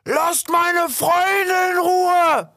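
very high, drawn-out furious shout. A narrator's reading. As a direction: bellow it down from above. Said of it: "einen Schrei von weiter oben".

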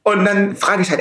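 ordinary delivery

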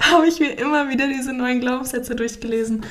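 words bubbling with real laughter; half laughing, half choked up; laughing through it. Real, not acted. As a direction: let the laugh carry the line. Laughs remembering reading affirmations.

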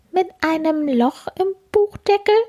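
little beetle finally admits, high voice. Acted. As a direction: pipe up like a small bug. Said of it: "fragt endlich der kleine Käfer".

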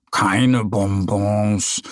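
slow, sulky grumble. A narrator's reading. Little bear finds no sweets, sulking slowly. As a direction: let the disappointment drag. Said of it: "mault der kleine Bär".

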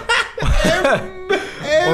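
loud burst of laughter; lautes Lachen. Real, not acted. Lacht über eigene Bewertung. (lautes Loslachen)

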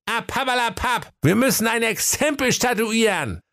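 very high, impatient correction; Wilhelm correcting. Acted. Wilhelm corrects his brother, high and impatient. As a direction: brush it aside and say it right.

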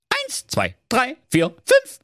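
immer höhere Kinderstimmen; Geißlein zählen. Acted. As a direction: der Reihe nach abzählen. (steigend hohes Durchzählen)

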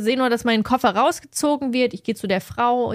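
ordinary delivery

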